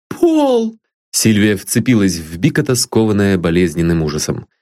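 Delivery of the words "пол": put-on high, eager young girl pleading sweetly; a lovesick girl dreaming of a home; high girlish voice full of hope; young girl Sylvia. A narrator's reading. Sylvia cries out.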